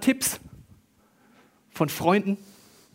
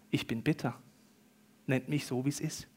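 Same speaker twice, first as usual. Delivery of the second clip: low, soft, subdued admission; bitter resignation; 3 semitones lower, 8 dB quieter than usual. Acted. Nomi telling the women to call her bitter, resigned. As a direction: say it quietly, drained of sweetness. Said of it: "Mara bedeutet Bitterkeit".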